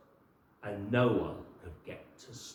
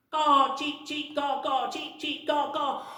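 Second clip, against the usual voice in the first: high louder caws and cheeps; bird calls; 13 semitones higher, 8 dB louder than usual. Acted. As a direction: call out like returning birds. Birds returning calling.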